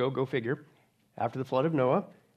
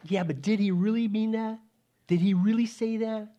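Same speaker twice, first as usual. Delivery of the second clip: lightly voiced sly tempter, a little higher; Satan's doubt; 5 semitones higher than usual. Preacher lightly voices Satan casting doubt on God's word. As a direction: plant doubt like the serpent. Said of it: "Satan comes around and says".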